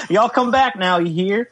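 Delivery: high, hammed-up Southern send-off; Southern accent. Shown as a demonstration. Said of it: "Southern real quick".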